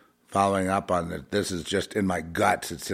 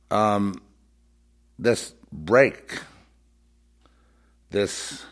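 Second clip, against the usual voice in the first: normal talking voice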